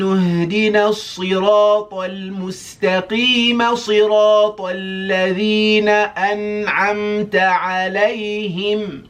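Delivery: high, loud melodic chant; Quran recitation. Shown as a demonstration. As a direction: recite the whole surah in flowing melody. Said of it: "I'll do one, uh, swoop through Surah Al-Fatiha".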